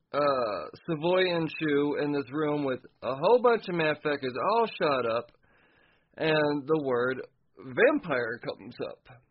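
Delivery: usual speaking voice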